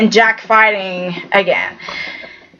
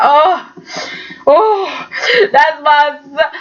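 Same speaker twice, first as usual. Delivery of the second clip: higher, tearful cry over her son; crying along; 3 semitones higher, slower than usual. Real, not acted. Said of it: "I was just so crying".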